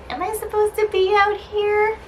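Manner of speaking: high, innocent little cat voice; put-on high voice for a clueless cat; voicing the cat. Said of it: "looking up at me like".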